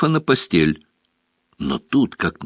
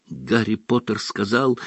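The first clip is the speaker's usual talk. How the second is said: usual speaking voice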